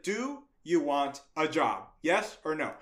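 slow, word-by-word question; slow deliberate repeat. Retold or imitated. Boss repeating the offer to a baffled kid, slow and deliberate. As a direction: spell out "Do you want a job" slowly.

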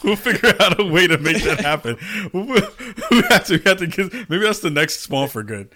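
high, laughing through the words; laughing while talking. Real, not acted. Host laughing as he plans the shanty show. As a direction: talk through real laughter.

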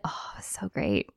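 hushed whisper, no voice. Acted. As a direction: breathe it out as a secret. Whispered aside.

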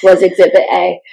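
raspy from screaming all day, pushed out; real hoarse voice, shouting the line. Real, not acted. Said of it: "That's why I sound like this".

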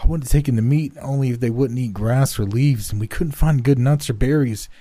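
put-on a scared boy blurting excuses all in one rush; terrified boy pleading. A narrator's reading. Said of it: "speaking the sheer momentum of terror".

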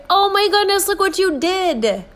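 put-on high sing-song praise for a toddler; praising a small child. Adult gushing to a little child, high and delighted. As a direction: gush over a little one, high and bright. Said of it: "You would say".

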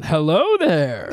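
slow drawn-out Obi-Wan greeting; Obi-Wan impression. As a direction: greet them slowly like a Jedi. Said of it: "do an Obi-Wan".

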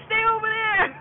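high, loud holler from afar; retold yell. Grandpa shouts to granddaughter fighting a fish, retold yell. Grandpa yelling across to her.

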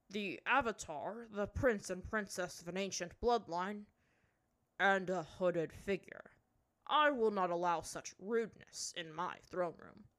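a ruler explaining with weight; stern chief. A narrator's reading.

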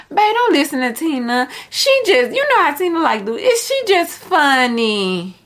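lightly high mock girlfriend voice; imitating a girlfriend. Girlfriend talking her man out of a friend's advice, mock sweet and high. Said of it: "She like".

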